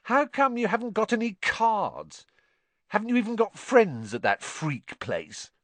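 high-pitched mocking questions; sneering, put-on bully's voice; sneering taunt. A narrator's reading. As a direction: needle him like a school bully. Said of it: "sneered Dudley".

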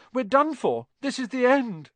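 high-pitched, doomed wail; moaned. Acted. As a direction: wail helplessly, certain it's over. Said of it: "Ron moaned".

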